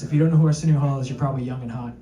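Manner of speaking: usual speaking voice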